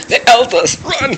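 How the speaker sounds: high, loud alarmed yell; panicked shout. Real, not acted. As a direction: yell in fright to flee.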